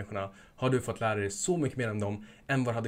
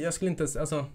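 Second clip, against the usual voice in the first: ordinary delivery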